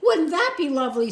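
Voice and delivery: high, loud wistful sarcasm; the uncle mocking his poverty aloud; sarcastic uncle. A narrator's reading. Uncle sighing sarcastically to the soldier, high and loud.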